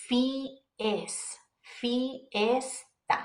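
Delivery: "fies, fiesta" drawn out, I and E as two separate vowels. Shown as a demonstration. Teacher shows learners how to split the vowels, I and E separate. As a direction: sound both vowels in "fiesta": fi-es-ta. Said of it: "pronounce the I and the E separately".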